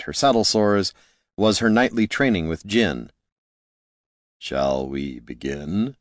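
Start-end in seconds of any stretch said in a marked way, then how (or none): 4.4-6.0 low, soft and slow, a calm man; Jin's voice. A narrator's reading. Wizard inviting Loruna to begin training, low and slow. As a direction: voice Jin deeper and unhurried. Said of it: "Jin asked".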